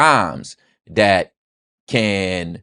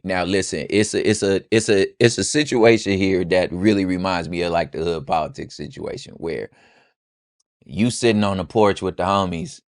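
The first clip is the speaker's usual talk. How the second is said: ordinary talk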